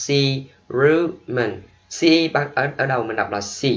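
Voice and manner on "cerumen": "Cerumen" very slowly, si... ROO... men; stress on middle syllable. Shown as a demonstration. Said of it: "Nhấn âm giữa".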